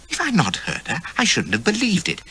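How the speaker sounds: usual speaking voice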